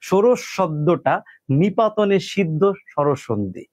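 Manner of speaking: "ষোড়শ শব্দটা" spelled out slowly, word by word; slow dictation. Shown as a demonstration. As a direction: dictate the answer slowly for students to copy. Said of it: "তাহলে আপনার উত্তর হবে ভাই". (ধীরে ধীরে বলে দেওয়া উত্তর)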